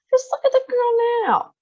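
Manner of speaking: high indignant mother voice; outraged mother. A narrator's reading. Mother rebukes Mister Wonka, high and indignant.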